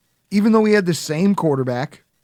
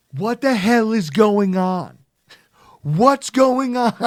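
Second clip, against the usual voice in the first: slow, louder, higher mock Trump yell; Donald Trump screaming; 6 semitones higher, 4 dB louder, slower than usual. Host mimics Trump clip mocking panicking fans, slow loud yell. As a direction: drag out a hammed-up Trump rant. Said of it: "a montage of Donald Trump screaming".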